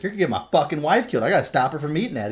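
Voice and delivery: mock outburst. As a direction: rattle it off like an alarmed host. Retelling Taffer's outburst at recon.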